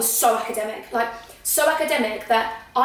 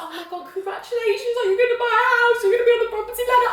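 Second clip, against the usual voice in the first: high, rushed tearful congratulations; mock crying; 11 semitones higher, faster than usual. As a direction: gush congratulations while pretending to well up. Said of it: "I was crying".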